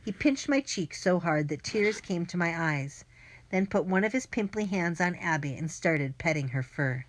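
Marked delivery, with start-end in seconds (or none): none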